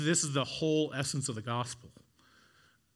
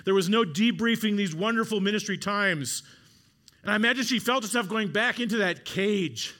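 louder, higher, driving sermon build; preaching at full force; 4 semitones higher, 4 dB louder than usual. Acted. Preacher listing what Mary lost, building loudly. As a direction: hammer each loss home to the congregation.

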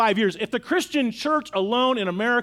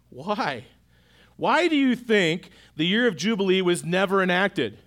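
usual speaking voice

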